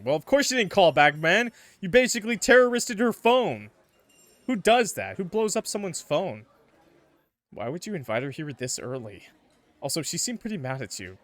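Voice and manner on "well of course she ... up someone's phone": loud, higher, exasperated rant; incredulous scolding. Real, not acted. Scolding Justin for blowing up her phone, loud and incredulous. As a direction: call him out, fed up and loud. Reader scolds character, half-joking.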